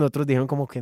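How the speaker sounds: usual speaking voice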